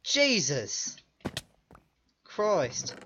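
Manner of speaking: shaken exclamation, a hint of fake Aussie-English accent; fake English-Australian accent. Real, not acted. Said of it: "My fake accent slipped through".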